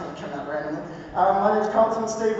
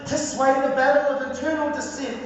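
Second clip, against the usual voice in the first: higher-pitched, measured poetry recital with weighted pauses; spoken word poetry; 6 semitones higher than usual. Acted. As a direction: deliver it as a rhythmic spoken word testimony. Performs spoken word poem.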